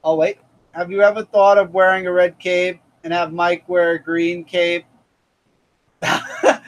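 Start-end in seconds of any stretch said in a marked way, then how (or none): none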